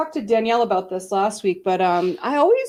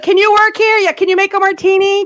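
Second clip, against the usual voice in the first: loud, high, hurried manager voice; mocking the harried wedding manager; rushed boss; 7 semitones higher, 6 dB louder than usual. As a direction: bark quick hiring questions over the noise. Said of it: "They're like".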